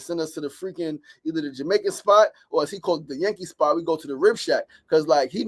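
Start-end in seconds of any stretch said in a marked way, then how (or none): none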